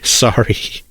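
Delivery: breathy laugh through sorry. A narrator's reading. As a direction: apologize while still laughing. Chase still laughing after outburst.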